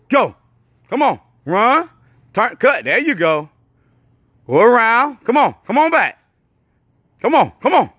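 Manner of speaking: loud coaching shouts across the field; coach yelling. Real, not acted. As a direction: bark the drill commands at full volume.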